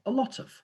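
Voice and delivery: "a lot of" run together as one chunk; linked chunk. Shown as a demonstration. Teacher modelling the whole chunk, "a lot of" linked together. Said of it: "focus on the whole chunk".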